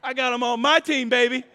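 loud high swagger, showing off; cocky excited shout. Retold or imitated. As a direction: crow it like you've got the toughest guys backing you. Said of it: "walking around that place now like".

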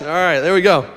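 ordinary talk

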